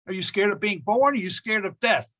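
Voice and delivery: ordinary talk, nothing marked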